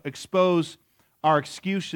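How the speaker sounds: ordinary delivery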